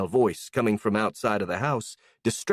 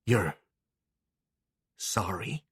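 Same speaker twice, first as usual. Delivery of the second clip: hushed, quiet disbelief; softly; 3 semitones lower, 5 dB quieter, slower than usual. Acted. A girl hearing her old tormentor apologise, softly. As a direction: ask it softly, the calm before the storm. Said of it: "Clara said softly".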